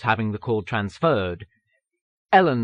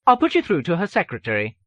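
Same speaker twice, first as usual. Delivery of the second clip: lightly voiced, higher-pitched receptionist; 7 semitones higher, 3 dB louder than usual. A narrator's reading. Receptionist transferring an author's call, lightly voiced and higher. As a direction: put on a hint of a switchboard voice. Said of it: "The receptionist had barely paused".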